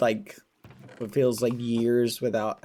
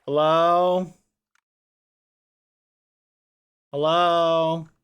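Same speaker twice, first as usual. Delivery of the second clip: calling out; 6 semitones higher, 6 dB louder, much slower than usual. Acted. Calling into the void.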